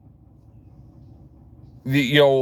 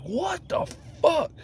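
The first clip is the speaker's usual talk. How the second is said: disbelief; 14 semitones higher, 6 dB quieter than usual. Retold or imitated. Disbelief at news.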